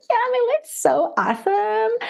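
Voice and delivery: very high, put-on cheerfulness; mock-perky squeal hiding misery; fake happiness. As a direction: overplay a sugary everything-is-great front. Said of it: "what I was portraying on the outside".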